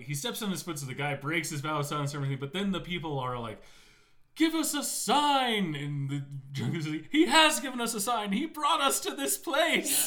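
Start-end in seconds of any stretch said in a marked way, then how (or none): 4.4-5.7 high, loud, slow demand; demanding crowd. Retold or imitated. 7.1-10.1 high, loud, laughing proclamation; excited follower. Retold or imitated. Drunk hails the prophet.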